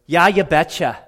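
a mild Minnesota betcha; Minnesota accent. Retold or imitated. Minnesota folk agreeing with Joshua, mild Minnesota accent. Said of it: "as the northerners say ... Minnesota".

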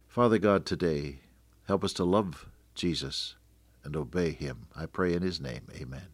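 soft, fading radio prayer; closing prayer. Real, not acted. Radio preacher closing the sermon in prayer. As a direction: pray quietly over the listeners.

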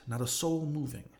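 usual speaking voice

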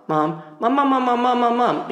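put-on nagging kid repeating Mom, speeding up and rising; insistent rapid-fire pleading. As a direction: pester Mom in a rapid, climbing run. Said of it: "it would just go faster and faster".

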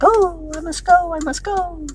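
high, airy mock-dithering voice; mock oblivious driver. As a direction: play the dithering driver, sing-song. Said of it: "they're like".

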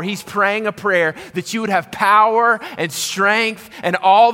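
high, loud, impassioned sermon delivery; full-force preaching. Real, not acted. Pastor urging the hurting to trust God's strength, full-force preaching. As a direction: preach it hard, high and loud, leaning on key words.

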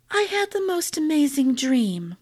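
wistful and wondering; dreamy wife voice. A narrator's reading. Bianca recounts dream dreamily.

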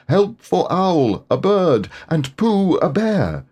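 high, slow calling out; shouting for help. A narrator's reading. Piglet shouting for the others to help, calling out high. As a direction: call for help across the wood. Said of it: "he stood and shouted".

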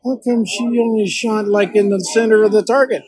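put-on high, puzzled kid's voice; a hint of a baffled little boy. Boy asking why the girl shot better, high and puzzled. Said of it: "when the boy says".

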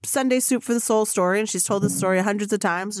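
normal talking voice

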